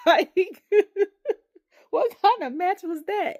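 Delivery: high, loud question through laughter; laughing in disbelief; incredulous laughing. Retold or imitated. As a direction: crack up while questioning it. Mocking the match while laughing.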